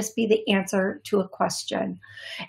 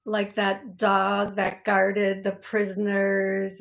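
normal talking voice

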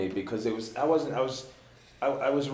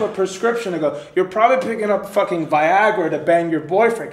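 getting mad; 5 semitones higher, 8 dB louder than usual. Retold or imitated. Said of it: "'cause now I'm getting mad".

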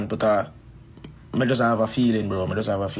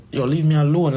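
louder, higher retold protest; irritated brush-off; 4 semitones higher, 5 dB louder than usual. Performer retelling telling someone behind him to back off, irritated. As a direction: push them away with a raised voice.

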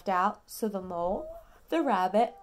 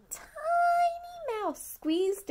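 higher, slow little voice for the mouse; tiny voice; much slower than usual. A narrator's reading. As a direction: shrink your voice to mouse size. Story read to toddler.

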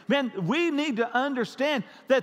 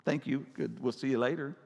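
casual invite; 7 semitones lower, 4 dB quieter, faster than usual. Retold or imitated. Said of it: "Don't just invite them".

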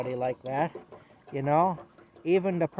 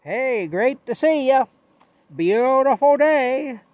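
high, loud and drawn out slowly; cheerful hobo greeting; 9 semitones higher, 10 dB louder, slower than usual. Retold or imitated. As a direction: beam it out to a passerby like the happiest man alive. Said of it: "He'd say".

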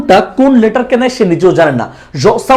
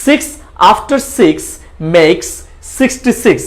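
very slow, word by word, each six with a clear s; slow tongue twister; much slower than usual. Shown as a demonstration. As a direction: spell out the tongue twister slowly. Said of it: "আবারও বলি". (ধীরে ধীরে tongue twister)

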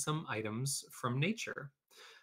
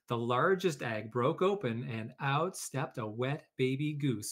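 ordinary delivery, nothing marked

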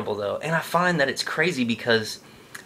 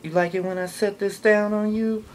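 put-on much higher, teasing girlfriend tone; 10 semitones higher than usual. Girlfriend voice talking dirty.

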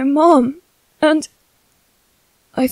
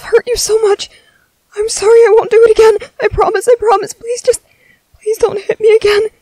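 high, loud, fast tearful pleading; frightened begging; 6 semitones higher, 4 dB louder than usual. Acted. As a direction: beg through tears, rushing, terrified of another blow. Begging not to be hit.

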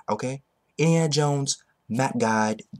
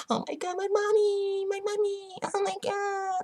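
high, far squeaky whine of my money; mocking a fan crying over lost money; mock whiny fan; 4 dB quieter than usual. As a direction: whimper like a broke fanboy.

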